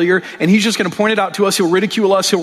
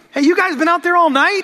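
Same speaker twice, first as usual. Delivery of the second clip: much higher, playful teasing voice; teasing Jesus; 12 semitones higher than usual. Retold or imitated. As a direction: rib the fishermen like a friendly joker. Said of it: "Jesus standing there going".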